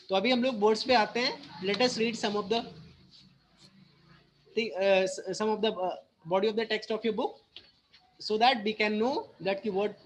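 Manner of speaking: ordinary talk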